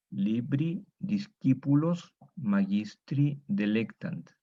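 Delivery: Latin read slowly word by word; slow reading aloud. Shown as a demonstration. Teacher reads the Latin sentence slowly to the class. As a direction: spell it out slowly for students. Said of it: "vamos a leer".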